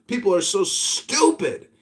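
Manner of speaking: ordinary delivery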